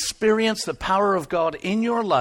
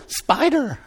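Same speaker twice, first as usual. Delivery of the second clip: mock shriek of fright; 8 semitones higher than usual. Preacher mocking English spider panic for laughs, high shrieking. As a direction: shriek like someone terrified of a harmless spider. Imitating scared English person.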